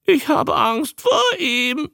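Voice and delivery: high, slow creature squawk; drawn-out high croak; newt blurting out. A narrator's reading. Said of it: "stieß der Molch hervor".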